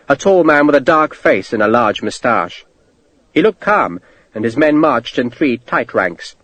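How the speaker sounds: ordinary delivery, nothing marked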